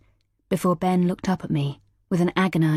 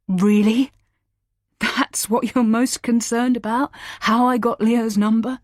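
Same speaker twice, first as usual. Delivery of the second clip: scornful disbelief; 6 semitones higher, 4 dB louder than usual. A narrator's reading. Husband mocks her question.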